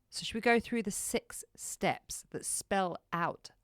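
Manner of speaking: slow, drawn-out delivery. Shown as a demonstration. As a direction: stretch every word out slowly. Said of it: "Did you notice I slowed it down".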